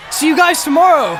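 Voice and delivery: loud, high farewell call. Acted. Band leader calls to the crowd, loud and high. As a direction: shout goodbye to the crowd.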